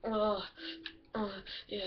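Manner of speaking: ordinary talk, nothing marked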